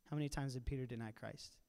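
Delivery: ordinary talk, nothing marked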